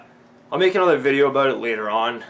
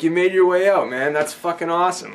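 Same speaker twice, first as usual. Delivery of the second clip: high, loud imitation of a hyped greeting; retold thrilled welcome; 7 semitones higher, 6 dB louder than usual. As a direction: cheer a fan's arrival, acting it out. Retold enthusiastic welcome from bodybuilder.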